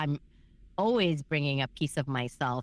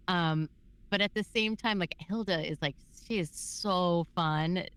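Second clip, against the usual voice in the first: ordinary delivery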